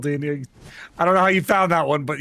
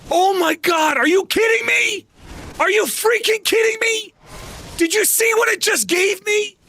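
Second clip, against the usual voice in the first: furious shout; 13 semitones higher than usual. Real, not acted. Streamer raging at the game's drop, shouting. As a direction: blow up at the game in disbelief. Game gives drop after grinding.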